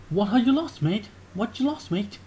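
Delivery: cocky London geezer asking his mate; lightly voiced chav quizzing a mate; British lad accent. [name] asks Marco about his pockets, cocky British accent.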